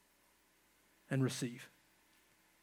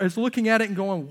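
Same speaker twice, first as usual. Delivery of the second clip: ordinary talk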